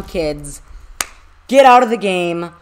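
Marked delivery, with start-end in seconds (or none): 1.5-2.5 loud, high, elated shouting; hyped full-volume celebration; victory yell. Real, not acted. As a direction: holler the sign-off in triumph. Victory sign-off.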